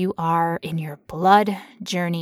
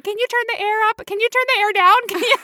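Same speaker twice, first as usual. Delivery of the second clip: much higher, hammed-up begging; mock pleading request; 13 semitones higher than usual. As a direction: whine the request like a needy text. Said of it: "They were texting her husband".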